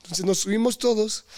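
ordinary talk

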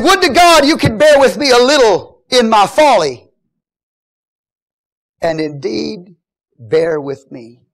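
high, loud declaimed plea; impassioned plea. Acted. Paul pleading with the Corinthians, impassioned shout. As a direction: cry it out to the congregation.

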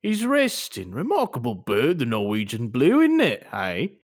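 evasive British shopkeeper, pitch swooping; wheedling British shop owner; British accent. Acted. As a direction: deny it in a put-on British accent. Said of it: "We're gonna try our British accents".

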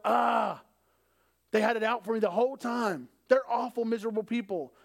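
loud, high mock outrage; groan, then complain hotly; indignant. Assuming the worst of someone, acted indignation. Acts out resentful assumption about others.

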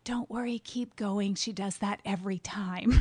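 usual speaking voice

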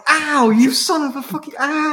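high, loud hammed-up cry of pain; mock pained yell. Imitating Jack after gunshot down the phone, mock pained yell. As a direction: yelp and curse in exaggerated agony. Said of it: "And Jack's like".

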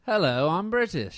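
half-convincing attempt at a British accent; bad British accent. Shown as a demonstration. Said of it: "Now you do yours".

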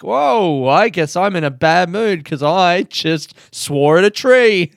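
loud high surprised whoa; sudden realization out loud. Retold or imitated. Quoting realizing a bad mood.

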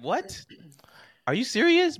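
high-pitched baffled reaction; acted-out disbelief, pitch jumping up; incredulous. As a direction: react like you can't believe what you read. Voices inner reaction to comments.

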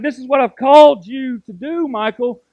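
God calling out loud and high; booming voice of God. Retold or imitated. God commanding his servant Michael, loud and high. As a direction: proclaim it with divine force. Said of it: "God's like".

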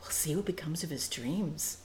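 breathy dreamy whisper; soft faraway whisper; dreamily. Shown as a demonstration. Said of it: "They can say it dreamily".

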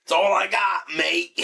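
high, loud mock Australian; hammed-up, botched Australian accent; bad Australian accent. Admitting the limits of the accent, loud mock Australian.

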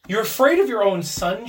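high, loud accusing cry; struggling cry. A narrator's reading. Wife struggling against her husband, crying out. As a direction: cry it out in disbelief. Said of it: "she cried, struggling".

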